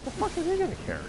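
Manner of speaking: mock incredulous retort; incredulous outburst. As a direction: scoff it in a high squeak. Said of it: "I'm like".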